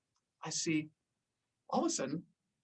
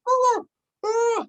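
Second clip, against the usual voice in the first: much higher, louder panicky cry; panicked oh; 5 dB louder than usual. Retold or imitated. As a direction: alarm rising as something's wrong. Friend realizes tank floating away.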